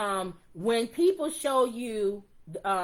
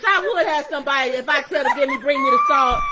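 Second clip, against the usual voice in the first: mocking whiny wife; 3 semitones higher, 7 dB louder, faster than usual. Mocking women's wish for helpers.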